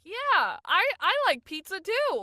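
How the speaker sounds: high, loud mock enthusiasm; awkward late reply. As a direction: overdo a cheery, clumsy reply.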